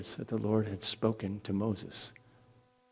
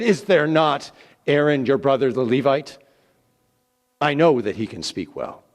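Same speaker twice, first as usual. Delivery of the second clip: angry; 5 semitones higher, 10 dB louder than usual. Acted. The anger of the Lord.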